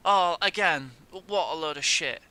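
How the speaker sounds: loud, real disgusted curse; angry curse. Real, not acted. Gamer cursing after conceding again, loud disgust. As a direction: spit it out in disgust.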